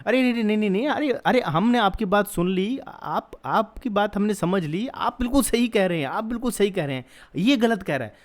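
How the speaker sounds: high-pitched, placating reassurance, imitated; mock-soothe someone you think is crazy; humouring a madman. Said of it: "ये मौलाना को ऐसे समझा रही है".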